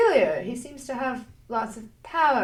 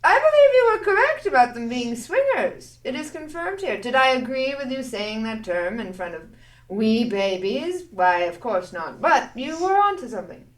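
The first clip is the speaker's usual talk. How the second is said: prim character voice; 2 semitones higher, 6 dB louder than usual. Acted. Character addresses Viva in-character.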